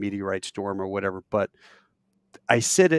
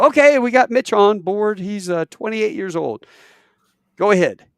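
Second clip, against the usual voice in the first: loud, higher-pitched on-air announcing; radio host; 9 semitones higher, 8 dB louder than usual. Retold or imitated. Hosts putting caller Mitch on air, mock radio host. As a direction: introduce the caller brightly to the listeners.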